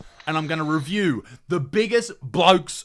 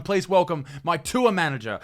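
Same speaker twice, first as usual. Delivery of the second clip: usual speaking voice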